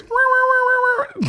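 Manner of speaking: loud, very high wailing wah-wahs; imitated alarm siren. Telling how the lady sounds the alarm, imitated siren. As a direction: wail like a store alarm going off. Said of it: "all you hear is".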